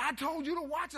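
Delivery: loud, high-pitched mock scolding; retold angry dad. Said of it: "He was mad".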